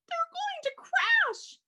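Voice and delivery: high, slow cry of alarm; alarmed gasp. Acted. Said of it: "gasped St-, Stella Luna".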